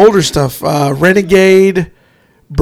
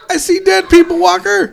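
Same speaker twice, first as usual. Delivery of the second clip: movie line impression; 11 semitones higher than usual. Mock movie line quote.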